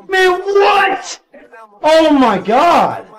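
high, loud cry of disbelief; real exasperated yell; disbelieving outburst. Real, not acted. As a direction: explode in disbelief, then draw out the words. Upset reaction to a loss.

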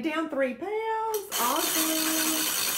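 high, slow, stretched-out celebration; excited cheer. Real, not acted. Host cheers a member who lost weight, high drawn-out excitement.

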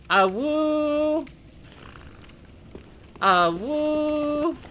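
high playful howl; howling like a wolf pup. Pretend. High howling cries repeated.

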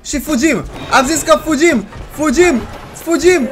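high, loud alarmed commands; urgent panic. Real, not acted. Player flees as Roxy breaks doors, panicked shouting. Urgent flight from game enemy.

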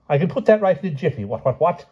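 high, quick, blustering royal voice; flustered eager king. A narrator's reading. King promises to fix his poem, high and flustered.

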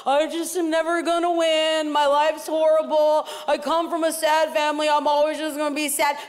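a hint of a gloomy, self-pitying whine; lightly voiced mopey sad sack; mopey Eeyore voice. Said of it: "like a spiritual Eeyore".

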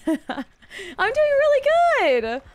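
high, slow, laughing reply; drawn-out giggly answer; laughing sing-song. Acted. Answering chat cheerfully.

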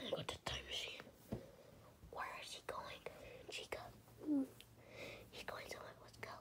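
sneaky whisper. Acted. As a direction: whisper so nobody wakes. Sneaking at night.

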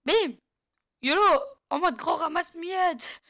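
high, loud whooping; excited battle cry. Real, not acted. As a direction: charge in shouting over the game. Whoops while attacking in game. (cri d'attaque surexcité, aigu)

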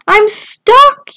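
high, loud yell for rescue. A narrator's reading. Stranded Tudley to the turtles below, yelling. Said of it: "yelled Tudley".

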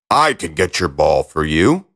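low-pitched talking frog; slightly put-on low frog voice; frog character. A narrator's reading. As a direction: offer help in a low frog voice. Frog first addresses the princess.

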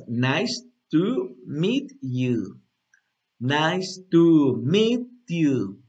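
"nice to meet you" very drawn out; slow demo. Shown as a demonstration. Teacher repeating the phrase for students, very slowly. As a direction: stretch every word for learners to follow. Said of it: "Una vez más".

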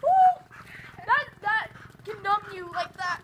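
high, loud gasp from the cold water; freezing shock, real. Real, not acted. Just jumped into cold pool.

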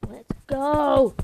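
loud, rising cheer; excited cheer. Real, not acted. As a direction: cheer the team on. Cheering a win in game.

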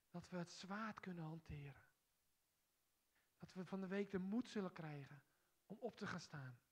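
intimate church prayer aloud; heartfelt prayer. Acted. Leads congregation in prayer.

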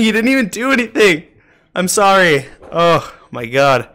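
ordinary delivery

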